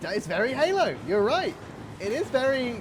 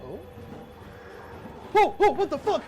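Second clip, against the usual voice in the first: raging gamer; 9 semitones higher, 8 dB louder than usual. Real, not acted. Gamer panicking at a monster.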